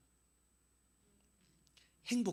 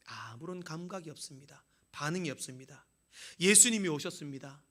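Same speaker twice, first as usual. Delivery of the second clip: loud high sermon build into praise; fervent preaching into a grateful prayer; preaching at full force; 4 semitones higher, 5 dB louder than usual. Acted. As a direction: cry out thanks to God from the pulpit.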